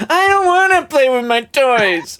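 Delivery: high-pitched whining tantrum; spoiled brat. Acted. Game master mocking the calmed barbarian, spoiled brat whine. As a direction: whine it out like a sulking child. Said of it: "very much spoiled brat".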